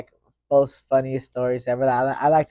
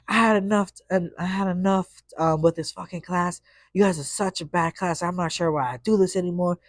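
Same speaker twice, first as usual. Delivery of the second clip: higher, imitating a teacher who is fed up and crying; upset teacher; 5 semitones higher than usual. Teacher giving up on the class, mock tearful. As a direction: mimic her quitting speech. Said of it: "so she goes".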